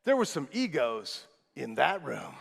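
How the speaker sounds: ordinary delivery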